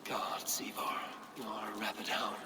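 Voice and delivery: exasperated whisper under the breath; whispered exasperation. Acted. Eivor hiding, whispering about reckless Ivar. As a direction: hiss it out so the guards won't hear. Hidden, muttering in stealth.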